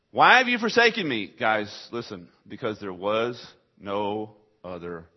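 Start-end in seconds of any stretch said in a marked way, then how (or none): 0.1-1.3 a loud, high cry of abandonment; anguished cry. Acted. Repeated cry of Jesus.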